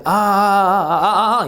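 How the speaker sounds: halting drawn-out ah sounds; hesitant stammer. Retold or imitated. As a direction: stall on repeated ahs like a blank. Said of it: "이러면 사 점이에요".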